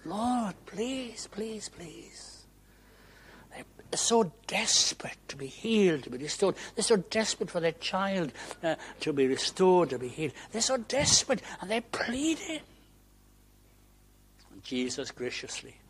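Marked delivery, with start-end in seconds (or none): 0.0-2.3 high, drawn-out begging that fades away; implore, fading on each please; pleading. Acted. Preacher voicing the sick begging Jesus for healing, pleading. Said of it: "And they plead". 3.5-12.6 high, impassioned retelling; desperate urgency. Acted. Preacher describing parents desperate for a child's healing, urgent.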